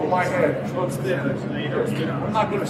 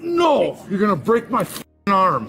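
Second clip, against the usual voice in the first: pained yelling; 2 semitones higher, 4 dB louder than usual. Real, not acted. Yelling in pain, arm twisted.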